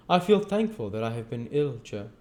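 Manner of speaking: put-on sincere young man, touched; young Pip voice. Pip speaks to Joe.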